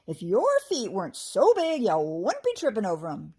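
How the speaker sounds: loud mocking goblin taunt; jeering goblin. A narrator's reading. First goblin mocking the one who tripped, jeering. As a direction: jeer at the clumsy one. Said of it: "jeered the first goblin".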